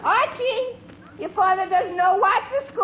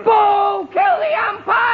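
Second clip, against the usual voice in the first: loud jeering yell at the umpire; heckling shout; 3 semitones higher, 5 dB louder, slower than usual. Acted. Fan yelling at the radio umpire, loud jeering. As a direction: boo and bellow at the ump.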